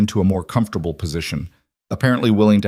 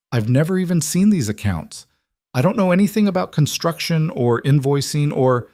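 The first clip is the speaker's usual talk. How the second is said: higher, rushed desperate protest; frightened pleading; 7 semitones higher than usual. A narrator's reading. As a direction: plead your innocence, scared. Frightened woman pleading innocence.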